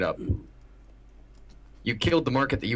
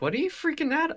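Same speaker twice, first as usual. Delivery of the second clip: confused. Retold or imitated. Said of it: "I'm like".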